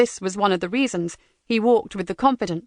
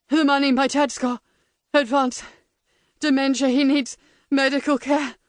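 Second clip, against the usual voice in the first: gasping out each word; out of breath; 3 semitones higher than usual. Acted. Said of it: "Each word puffed from his lips".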